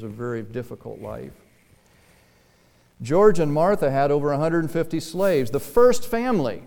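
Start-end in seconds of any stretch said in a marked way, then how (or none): 3.0-6.6 loud, rising preacher's voice; emphatic preaching. Real, not acted. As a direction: drive it home, leaping higher at the end. Preaches at full force.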